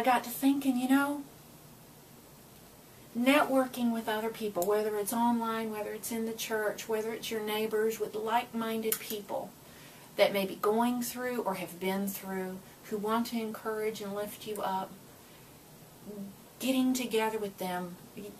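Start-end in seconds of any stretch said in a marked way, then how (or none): none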